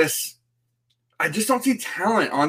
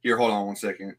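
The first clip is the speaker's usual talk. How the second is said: ordinary talk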